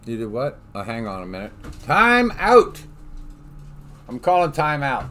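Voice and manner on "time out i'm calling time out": loud high call for time out; shouting. Real, not acted. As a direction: yell it to stop the game. Calling a timeout loudly in game.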